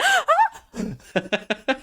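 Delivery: a high startled shriek, half laughing; startled mock scream. Star recalls shrieking at the famous actor, high startled squeal. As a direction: squeal in shock at the surprise. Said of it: "And I just screamed".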